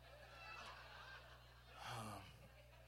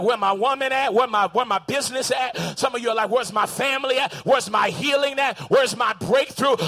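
louder chant of demands, slowing at the end; demanding cry; 9 dB louder than usual. Pretend. Churchgoers voiced crying out for what they want, loudly. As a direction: demand it like you've waited too long. Voicing congregation's demands, shouted.

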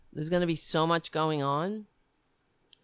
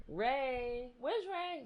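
high, soft calling voice; softly calling for the cat Ray; 7 semitones higher, 9 dB quieter, much slower than usual. Real, not acted. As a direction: coax the cat in a much higher voice. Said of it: "I'm trying to change the tone of my voice".

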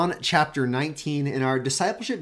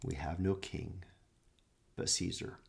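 near-whispered, much softer; hushed echo; 7 semitones lower, 13 dB quieter than usual. Acted. Preacher repeating the priests' answer, hushed. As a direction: breathe the words out in sorrow.

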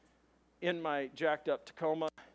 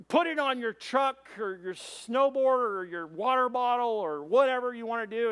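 loud, raised, emphatic sermon delivery; forceful preaching; 6 semitones higher, 7 dB louder than usual. Real, not acted. Preacher charges congregation to be witnesses, loud and forceful. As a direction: drive the point home to the congregation.

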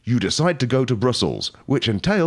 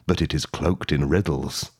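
ordinary delivery

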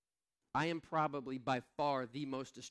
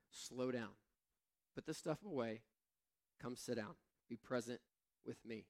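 softer, calm and tender; softly voiced Jesus calling Martha to rest; gentle Jesus; 2 semitones lower, 5 dB quieter than usual. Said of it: "Jesus was like".

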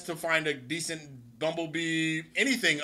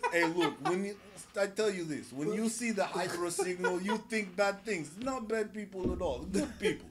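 a hint of a Russian spy; Russian accent; 4 dB quieter than usual. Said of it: "It's a pretty good voice".

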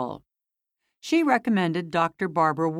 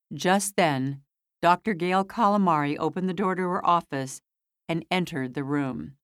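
usual speaking voice

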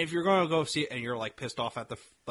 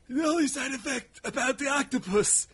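mock warning; 7 semitones higher than usual. Acts out the characters' octopus warning.

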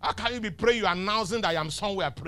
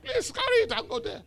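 much higher, squeaky teasing voice; high mock dismissal. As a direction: shoo them off in a silly high voice.

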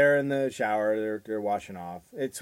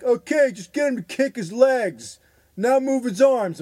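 slow, higher, patronizing instructor voice; mock swim instructor; 12 semitones higher, 7 dB louder than usual. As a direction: talk down like a bored teacher. Mocking swim class instructor.

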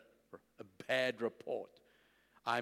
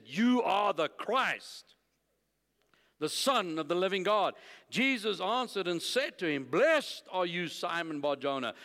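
raised, proclaiming Bible reading; emphatic scripture reading; 4 semitones higher, 4 dB louder than usual. Real, not acted. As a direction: proclaim the verses with weight on key words. Reads scripture aloud to congregation.

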